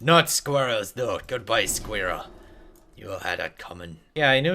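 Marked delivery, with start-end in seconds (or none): none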